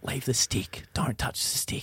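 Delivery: re-enacted whisper so nobody hears; urgent whisper. Man to his mate at the jammed door, whispered warning.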